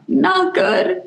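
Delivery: high-pitched, mock-weepy squeak; retold tearful reply. Woman confessing to Joe on the phone, imitating her tearful voice. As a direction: pitch leaps up as if about to cry. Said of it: "I start crying".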